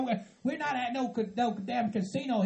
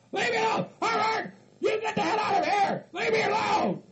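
furious hillbilly rant; 9 semitones higher than usual. Acted. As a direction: yell threats at the pranksters.

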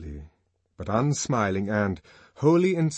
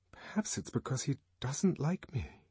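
soft to herself; 5 semitones higher, 6 dB quieter than usual. Acted. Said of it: "she said to herself".

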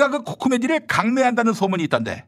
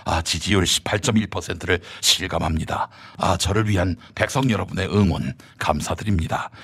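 low pompous politician. Acted. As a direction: thank the crowd grandly. Low voiced politician character.

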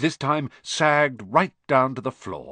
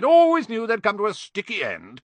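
character voice; 9 semitones higher, 6 dB louder than usual. Acted. Character voice in audiobook.

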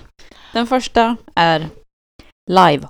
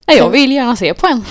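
eager reply; 6 semitones higher, 6 dB louder than usual. Retold or imitated. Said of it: "Och då så sa jag".

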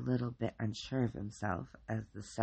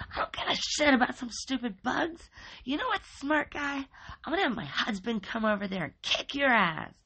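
high, loud, screaming mother; high shrieking neighbor mom; furious mom again; 12 semitones higher, 5 dB louder than usual. A narrator's reading. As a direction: yell it down the phone at him. Rita yelling on phone.

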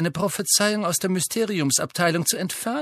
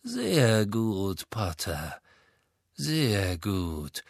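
slow; 4 semitones lower, 4 dB quieter, much slower than usual. Acted. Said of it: "sagte Malfoy langsam".

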